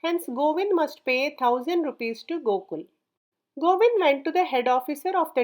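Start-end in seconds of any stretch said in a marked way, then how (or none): none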